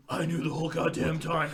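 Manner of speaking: a hint of Batman growl; lightly voiced Batman. Batman to his attacker at the twist, lightly growled. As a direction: reveal it as a rough-voiced hero.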